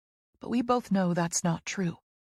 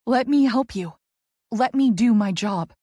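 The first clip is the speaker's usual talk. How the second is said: loud pleading; 3 semitones higher, 6 dB louder than usual. A narrator's reading. Pleading after the struggle.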